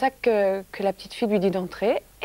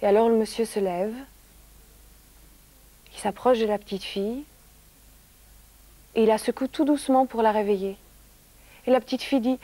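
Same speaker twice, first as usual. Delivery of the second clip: usual speaking voice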